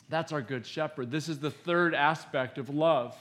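ordinary talk

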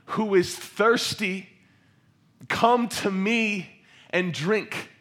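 loud, high, slow proclamation; crying out loudly. Acted. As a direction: call out across a crowd, drawing out the words. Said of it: "in a loud voice".

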